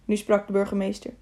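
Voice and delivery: ordinary delivery, nothing marked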